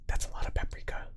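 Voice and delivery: soft unvoiced whisper of That's a lot of paprika; whispered aside. Real, not acted. Whispered then repeated plainly.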